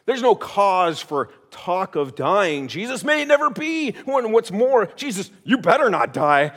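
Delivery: rebuking Peter. Acted. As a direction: Peter scolding his teacher, begging him not to die. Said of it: "he immediately rebukes him".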